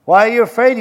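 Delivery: loud rebuke; sharp scolding question; Jesus scolding his frightened disciples, loud. Acted.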